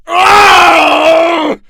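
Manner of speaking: very high, loud shout; a raw cry of strain; anguished cry. Acted. Chapman cries out fighting his host. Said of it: "Chapman cried".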